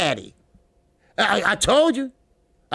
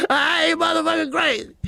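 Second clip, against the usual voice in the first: joking shout; 9 semitones higher than usual. Real, not acted. Host yells at chat that he isn't crazy. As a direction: shout the denial for laughs. Denying he's crazy, yelling.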